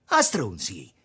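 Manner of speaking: loud, scornful jab; disgusted insult. A narrator's reading. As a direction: spit it out in disgust. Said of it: "parlando a schifio". (insulto detto a schifio)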